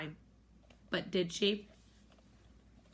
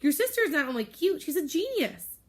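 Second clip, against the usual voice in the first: gushing praise; 7 semitones higher, 4 dB louder than usual. Acted. Rose gushing over baby sister.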